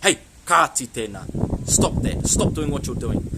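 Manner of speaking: slow, loud, firm command; acted telling-off, each word weighted; parent scolding children. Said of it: "use it with your tamariki".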